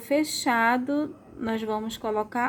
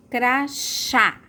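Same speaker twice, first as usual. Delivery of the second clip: "crachá" in two beats, strong CHÁ; "crachá" split, with falling open final á; stressed final syllable; 3 semitones higher, 4 dB louder than usual. Shown as a demonstration. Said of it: "Qual a sílaba mais forte?".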